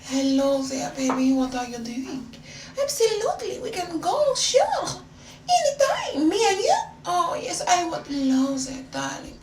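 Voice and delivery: put-on high, flirtatious French-accented voice; hammed-up French seductress accent. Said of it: "I spoke in this French accent".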